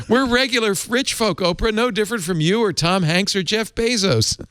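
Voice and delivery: a high, hammed-up Irish lilt for the couple's quote; bad Irish accent. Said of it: "I apologize for my bad Irish accent".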